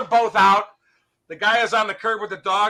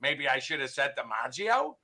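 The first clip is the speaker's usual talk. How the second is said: musing talking dog; 4 semitones lower, 7 dB quieter than usual. Acted. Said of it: "Fido looks at him and says".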